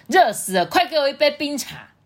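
loud, high demanding dad; 大声喊. A narrator's reading. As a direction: call out an order, hot and impatient. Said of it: "就大声喊说". (爸爸不耐烦地大声使唤)

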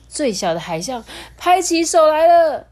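high, bright storybook climax; excited narration. A narrator's reading. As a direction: build up the joy as they clap.

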